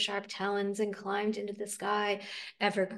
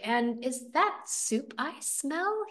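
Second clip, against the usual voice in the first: put-on slow greedy old toad; 6 semitones higher, much slower than usual.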